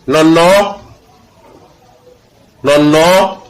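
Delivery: "non, non" with the last O drawn out; long vowel example. Shown as a demonstration. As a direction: let the second "non" stretch and rise. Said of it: "la formation de certaines voyelles longues".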